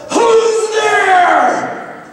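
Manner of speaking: sudden high frightened shout; yell. Retold or imitated. Husband yelling at a noise on the stairs, high shout. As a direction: bark it out into the dark. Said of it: "I yell".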